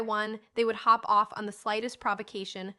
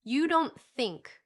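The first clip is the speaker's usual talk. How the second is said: slow; 4 semitones higher, 4 dB louder, much slower than usual. A narrator's reading. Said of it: "began Missus Pepper again".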